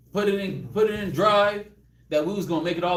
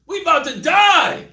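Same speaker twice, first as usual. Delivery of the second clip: desperate plea; 7 semitones higher, 8 dB louder than usual. Retold or imitated. Disciples waking Jesus, loud and desperate. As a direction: beg to be saved. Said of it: "Lord, save us. We perish".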